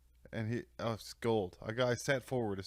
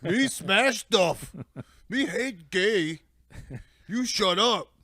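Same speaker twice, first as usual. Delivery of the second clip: very slow, much louder, higher dumb brute voice; caveman robot voice; 10 semitones higher, 11 dB louder, much slower than usual. Retold or imitated. Dinobots voiced as dumb brutes, caveman talk. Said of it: "They were like".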